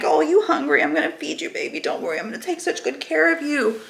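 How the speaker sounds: put-on high cooing baby talk; mothering coo to her infant self. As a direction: coo over a hungry baby.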